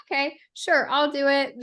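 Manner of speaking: mock teen tantrum, grudgingly giving in; sulky teenager. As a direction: agree like a pouting teen mid-tantrum. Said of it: "a teenager throwing a temper tantrum".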